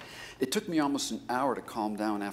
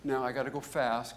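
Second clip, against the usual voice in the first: ordinary talk, nothing marked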